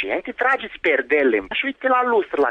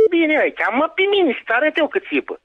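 angry taunting shout; 10 semitones higher than usual. Real, not acted. Neighbour mocking the caller's wife, shouting angrily.

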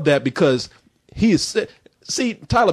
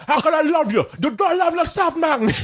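high, strained mock-Cuban accent; mocking Scarface-style Cuban plea; fake Cuban accent; 12 semitones higher than usual. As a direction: ham up a terrible Cuban accent. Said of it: "you're not really from Cuba".

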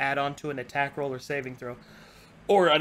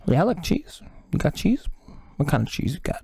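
gruff cartel boss voice; sly cartel thug sizing up cheese; leech gangster. Acted. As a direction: play a Mexican gangster leech.

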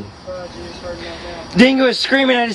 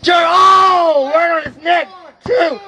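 shocked excitement; 4 semitones higher, slower than usual. Real, not acted. Commentator seeing a man land on his neck, shocked shout. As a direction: react aloud to a brutal landing.

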